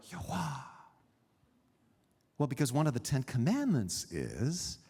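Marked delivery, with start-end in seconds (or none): 0.1-0.6 second Yahweh breathed out airily. Shown as a demonstration. Preacher offering the alternative, Yahweh said as a breath. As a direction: breathe Yahweh instead of speaking it. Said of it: "pronounced like a breath".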